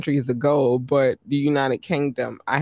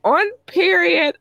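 period flung up high and loud; emphatic; 5 dB louder than usual. Real, not acted. Shouting out South Africa, emphatic period. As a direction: slam the word period home.

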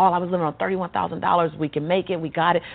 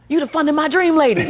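higher and louder, laughing as she says it; excited recognition; 5 semitones higher, 6 dB louder than usual. Retold or imitated. Bank teller recognizing the customer, laughing and excited. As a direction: burst out like spotting a celebrity. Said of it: "she's like, gasps".

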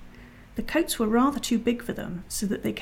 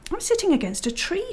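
high-pitched astonishment; amazed discovery; 10 semitones higher than usual. Acted. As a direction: exclaim it high, in wonder. Susan startled by a tree indoors.

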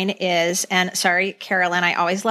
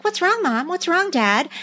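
mock innocent Jan; 8 semitones higher than usual. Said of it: "she's like".

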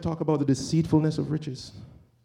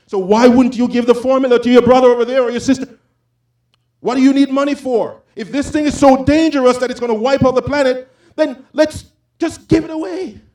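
higher, louder indignant preaching; rising righteous anger, full voice; outraged; 9 semitones higher, 8 dB louder than usual. Real, not acted. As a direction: demand it of them, building heat.

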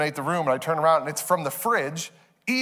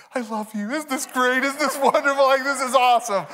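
high, gushing parent in love with the moment; gushing delight; 6 semitones higher than usual. Retold or imitated. Parents to each other during the movie, high gushing delight. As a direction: marvel at how great this is.

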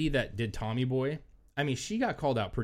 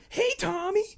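much higher, chirpy greeting; mock crowd greeting; 4 dB louder than usual. Seance crowd greeting the spirit Tommy, much higher and chirpy. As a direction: sing out hello like a believer. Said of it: "everybody's like".

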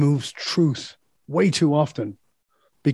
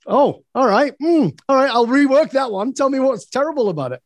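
mock eager; 7 semitones higher than usual. Mock eager reply to friends.

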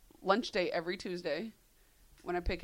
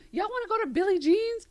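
put-on Southern accent; 11 semitones higher, 7 dB louder than usual. Wife mimics her husband's Southern accent inviting her out. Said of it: "like, accent is".

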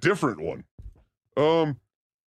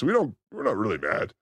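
ordinary delivery

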